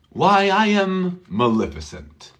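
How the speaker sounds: Why I am Maleficent said with a falling tune, not a question; statement why. Shown as a demonstration. Teacher modelling Maleficent's old-fashioned statement. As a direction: say why as a proud declaration, falling at the end. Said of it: "it's not a question".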